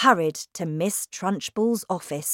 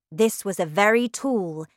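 plain reading voice

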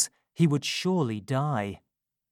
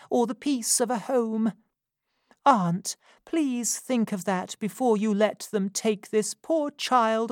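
tender plea; 8 semitones higher than usual. Acted. Rose begging her aunt to spare the boy, tender higher plea. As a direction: move her aunt to pity.

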